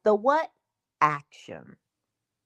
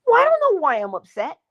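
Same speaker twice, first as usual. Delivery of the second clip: whining client; 10 semitones higher, 4 dB louder than usual. Retold or imitated. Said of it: "client answer".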